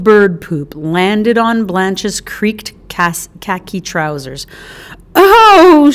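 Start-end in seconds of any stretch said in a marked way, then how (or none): 5.1-5.9 high, loud shocked "Oh!"; startled cry. Acted. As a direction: yelp at the bird dropping. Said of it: "she cried".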